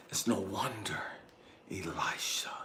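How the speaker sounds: low, very soft quote; hushed retold remark. Ex-wife talks about their son, hushed. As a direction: quote it gravely, almost whispering. Retells ex-wife's grave remark.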